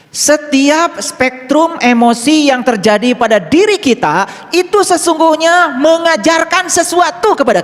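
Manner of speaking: high, loud and slow sermon line; slow preaching. Acted. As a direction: lay out the teaching slowly and forcefully.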